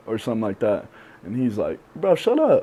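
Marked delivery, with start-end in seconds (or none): 1.9-2.6 high, loud mock telling-off; friendly scolding. Homie shutting down his self-doubt, high loud retort. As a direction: cut him off like an exasperated buddy.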